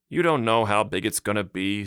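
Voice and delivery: wife exasperated with the mayor. Acted. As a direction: roll your eyes at your husband. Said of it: "said his wife exasperatedly".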